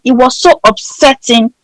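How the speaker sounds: normal talking voice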